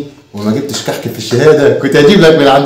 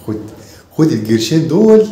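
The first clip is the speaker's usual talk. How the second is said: usual speaking voice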